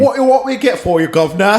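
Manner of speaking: a light Cockney smuggler voice; hint of Cockney, offering goods. Co-host playing a Cockney smuggler to the host, lightly Cockney. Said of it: "I appreciate the Cockney".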